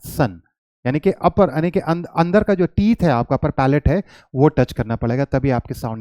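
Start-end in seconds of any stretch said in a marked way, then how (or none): 0.1-0.4 सन with a clear s, tongue to upper palate; सन with a hissed s, not sh; correct sun sound. Shown as a demonstration. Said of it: "सा बोलने के लिए आपको टंग को ऊपर को touch करना पड़ेगा".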